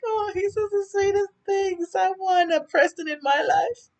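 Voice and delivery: very high, breathy swoon over a sweet guy; swooning falsetto. Retold or imitated. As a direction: gush it up high like a smitten fan. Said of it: "I was saying".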